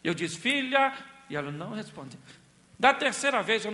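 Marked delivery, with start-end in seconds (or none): 0.4-0.9 louder and higher, calling sharply; husband calls wife, now annoyed; irritated call. Retold or imitated. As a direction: call her name with annoyance. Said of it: "já tava meio chateado".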